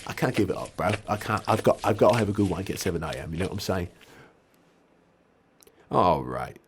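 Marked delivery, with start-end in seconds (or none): 0.1-3.9 pleading patient. Acted. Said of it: "he's like".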